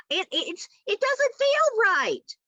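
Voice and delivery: high, slow, uneasy. Real, not acted. Describing unsettling turkey.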